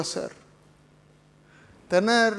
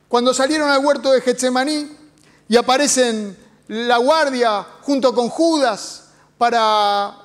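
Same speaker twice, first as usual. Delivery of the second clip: ordinary talk, nothing marked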